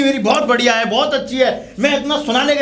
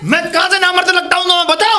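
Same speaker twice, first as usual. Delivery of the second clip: high, fast, outraged yelling; indignant shout; 6 semitones higher, 3 dB louder, faster than usual. Acted. Husband insulted by his wife, shouting indignantly. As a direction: shout it back, stung by the insult.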